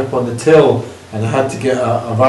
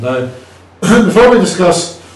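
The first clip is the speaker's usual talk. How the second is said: clearing throat; 5 semitones higher, 4 dB louder than usual. Real, not acted. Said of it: "I'm coughing because I'm medicated".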